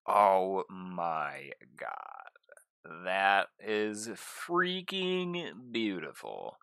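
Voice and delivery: drawn-out, very slow amazement; slow awe. Real, not acted. Seeing the rings up close, slow stunned awe. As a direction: stretch every word in wonder. Awed by rings after staging.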